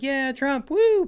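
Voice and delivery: very high celebratory whoop; mock cheering. Imagining protesters praising Trump, mock cheering. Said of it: "Maybe they're like".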